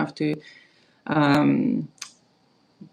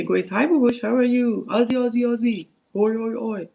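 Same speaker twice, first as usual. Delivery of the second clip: greeting the pets; 6 semitones higher than usual. Real, not acted. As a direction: chirp their names up high to greet them. Greeting the pets mid-video.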